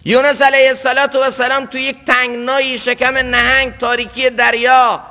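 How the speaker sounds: high, driving sermon voice; impassioned preaching. Real, not acted. Preacher recounting Jonah's plight, impassioned.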